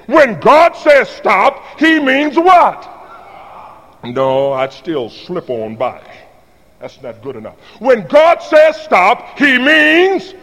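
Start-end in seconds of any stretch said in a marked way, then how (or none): 0.1-2.9 slow, stretched call for the answer; preacher call-out. Shown as a demonstration. Preacher calling for the congregation's answer, slow and stretched. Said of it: "No, that's a little better". 7.8-10.3 slow, stretched call for the answer; preacher call-out. Shown as a demonstration. Preacher calling for the congregation's answer, slow and stretched. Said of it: "No, that's a little better, but that, that's still weak".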